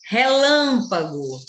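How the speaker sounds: "Relâmpago" with a loud, long LÂM; slow demo, weight on LÂM in "Relâmpago"; stress on LÂM. Shown as a demonstration. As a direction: punch and stretch the second syllable of "Relâmpago". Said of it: "Lã é a sílaba tônica".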